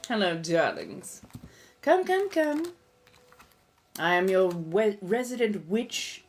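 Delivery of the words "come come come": put-on high sing-out invitation in posh accent; high beckoning call. Vampire host calling viewers in, high posh beckoning. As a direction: beckon guests in, pitched way up.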